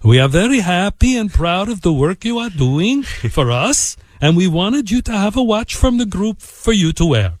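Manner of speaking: formal, lilting imitation of an Italian accent; imitated Italian executive's accent. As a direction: present it proudly in a put-on Italian accent. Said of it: "in this accent".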